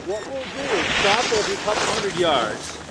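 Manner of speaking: calling out to the group on the slope; cheery, high call down the hill; cheery ski instructor shouting. Real, not acted.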